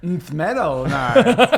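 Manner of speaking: slow reveal. Pretend.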